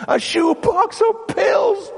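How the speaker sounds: high, drawn-out cry of disbelief; incredulous shout. Acted. Preacher stunned by the shoebox of pills, incredulous shout.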